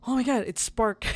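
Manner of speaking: a gasp, then a thrilled "Oh my God"; light up with sudden joy; excited gasp. Retold or imitated. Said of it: "I got so excited".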